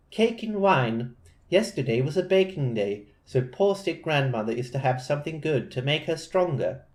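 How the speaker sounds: put-on a light British accent for the girl; a touch of British English, girlish and polite. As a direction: read her lines in a mild British accent. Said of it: "why I'm making her British".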